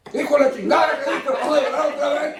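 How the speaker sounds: papá regañando en broma; mock angry dad. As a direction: play the exasperated Mexican father. Mexican dad scolding kid.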